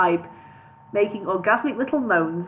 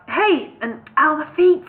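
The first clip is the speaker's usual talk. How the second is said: calling out; 6 semitones higher, 5 dB louder than usual. Acted. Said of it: "calling out".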